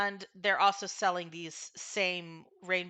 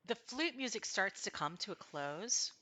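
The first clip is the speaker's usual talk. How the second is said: ordinary talk, nothing marked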